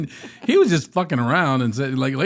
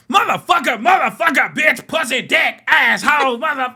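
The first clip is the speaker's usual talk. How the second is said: put-on high, loud rapid-fire cursing by children; kids swearing; 13 semitones higher, 6 dB louder than usual. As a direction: rattle off swears like gleeful kids. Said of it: "Lakin and Sean was like".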